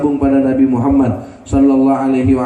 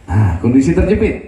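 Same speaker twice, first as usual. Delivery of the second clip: normal talking voice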